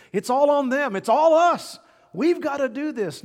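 high, loud mock of self-reliant panic; loud emphatic mock. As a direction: cry it out like the frantic world. Mocking the self-reliant world.

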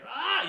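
short, piercing, very high horror-movie shriek; little zombie scream. Retold or imitated. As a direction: let out a blood-curdling zombie scream. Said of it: "does this little zombie scream".

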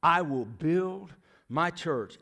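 slow and deliberate, each word placed; solemn slow quote of Jesus; slow, weighty proclamation. Acted. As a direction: lay it down slowly as a promise. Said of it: "he points to himself and says".

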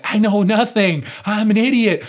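high, loud, hammed-up panic; mock panic. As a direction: cry out in mock self-despair. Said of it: "And I'm like".

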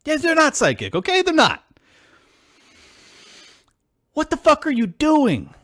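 high-pitched, exasperated outburst; really upset. Real, not acted. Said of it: "sorry for getting so upset".

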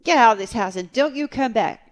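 loud, high hammed-up order; mock yell. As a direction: hands on hips, throw him out. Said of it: "hands on hips".

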